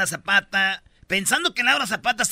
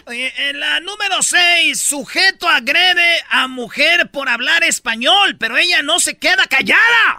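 louder, slow shouted news headline; loud announcement; 7 semitones higher, 9 dB louder, slower than usual. Real, not acted. Host shouting the next story to listeners. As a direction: call out the headline like a show host. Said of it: "announcing the next news item".

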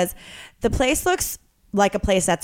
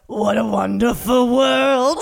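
slow, lightly voiced [name] croon; a hint of [name] crooning the title; slower than usual. Random voice answering the goodnight, mild [name] impression. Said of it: "great [name] impression".

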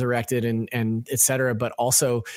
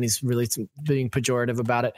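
ordinary delivery

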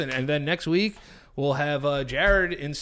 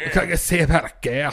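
a hammed-up Boston accent, slightly louder; Boston accent impression; 3 semitones higher, 6 dB louder, faster than usual. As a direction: imitate Will Hunting's Boston accent, badly, like Sean Connery. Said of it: "Sound like Sean Connery".

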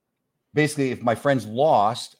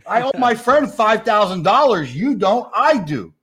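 much higher and louder, hammed-up outrage; outraged disbelief; 12 semitones higher, 8 dB louder than usual. [name] yells at Frank over the cheating excuse, outraged. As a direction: blow up in mock fury. Demanding money owed.

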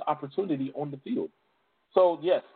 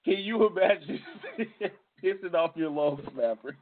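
words broken up by laughter; helpless chuckling through the sentence; real laughing. Real, not acted. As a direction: tell it while cracking up. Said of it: "I'm laughing because I'm".

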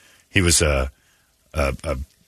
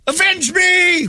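high, loud hammy death cry; overacted death scene; 4 dB louder, much slower than usual. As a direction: die dramatically, begging for vengeance. Dying victim's last words.

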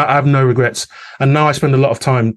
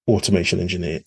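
ordinary delivery

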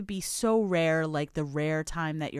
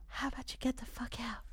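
airy, higher whisper of a diva; 7 semitones higher, 9 dB quieter than usual. Retold or imitated. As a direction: breathe the line out like a pop diva. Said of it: "in her breathy little voice".

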